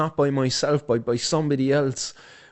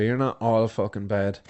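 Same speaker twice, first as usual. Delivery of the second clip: normal talking voice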